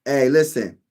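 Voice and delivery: ordinary talk, nothing marked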